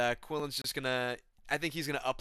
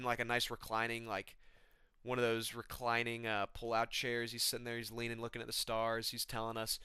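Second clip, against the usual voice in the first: ordinary delivery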